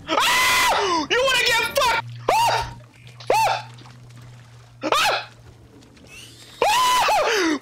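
high panicked shrieks; repeated screams. Real, not acted. Gamer screams high as a monster attacks him.

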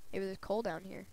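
ordinary talk

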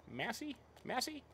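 high, rising mock query; questioning Massey. Real, not acted. Streamer asks chat about Massey, high and rising. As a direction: tease the chat with a lifted Massey?. Polling chat on tractor brand.

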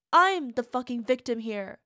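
loud yell of self-pity; angry yell. Acted. Partner insisting she's the victim, loud yell. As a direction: bellow it, claiming victimhood.

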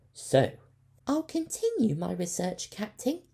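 a keen ship's doctor offering help; Leica's voice. A narrator's reading. Ship's doctor to the captain, high and eager.

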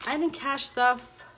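slow, loud startled realisation; realising a mistake. Real, not acted. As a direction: it suddenly dawns on her. Realises she missed an envelope.

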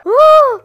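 high, loud whoop; loud battle holler; war whoop. Pretend. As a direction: whoop as the troops charge. War whoop after attack order.

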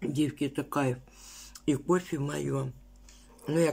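food in cheek; half-closed mouth with food; chewing while talking. Real, not acted. Seen 3: Bit food and sipped coffee earlier; cheeks bulge, lips pursed while speaking.

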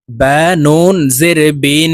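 slow spelling with "বিন" ending in a long nasal n; nasal ghunnah. Shown as a demonstration. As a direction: hold the n of "বিন" humming through the nose. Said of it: "নাকের বাসি থেকে গুন্নাহ করিয়া পড়িতে হয়". ("বিন"-এর নুনে নাকে টেনে গুন্নাহ)

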